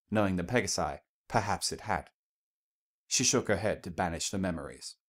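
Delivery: usual speaking voice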